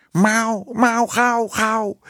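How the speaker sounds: high, urgent mewing cat voice; roepende poes. A narrator's reading. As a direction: meow the words out insistently. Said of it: "riep de poes". (hoog mauwende, roepende poes)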